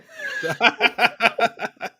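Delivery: high-pitched laughing fit; helpless high laughter. Real, not acted. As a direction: crack up at your own joke. Cracking up at own accent.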